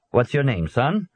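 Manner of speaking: put-on policeman with a light Swedish accent; a lightly voiced Swedish officer, firm. A narrator's reading. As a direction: Swedish UN policeman questioning a boy. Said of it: "the policeman asked with an accent".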